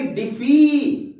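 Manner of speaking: डिफी with the final ee stretched out; drawn-out, emphatic "fee" ending on डिफी; long final vowel. Shown as a demonstration. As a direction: hold the long ee at the end of डिफी. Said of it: "यानी कि बड़ी की मात्रा".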